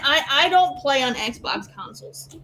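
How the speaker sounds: usual speaking voice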